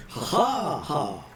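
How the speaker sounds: slow booming ha ha ha; hearty laugh. Acted. NPC herald laughs after speech.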